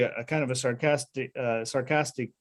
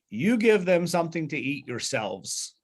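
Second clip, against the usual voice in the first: "You" leaned on hard, pitched up at the start; stress on YOU; 2 semitones higher than usual. Retold or imitated. Teacher stressing Jesus's reply to the disciples, emphatic on "You". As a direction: hit "You" first, then let the line fall to "yourselves". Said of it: "there's really emphasized is".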